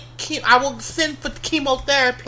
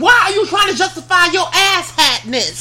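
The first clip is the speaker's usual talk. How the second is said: shouted retort, a touch higher; retold shout; 4 semitones higher, 4 dB louder than usual. Player retelling how she yelled at a rival, shouting. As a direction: yell it at the player trying to justify himself. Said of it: "I'm like".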